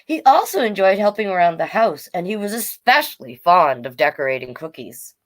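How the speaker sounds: bright, lively storybook reading; reading to children. Acted. Storytime host reading Elmer's story to young viewers. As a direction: read it out to a room of kids.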